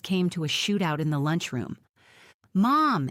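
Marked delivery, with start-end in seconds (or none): 2.5-3.1 loud worried call. A narrator's reading. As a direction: call out to Mom across the table. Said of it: "Angela cries".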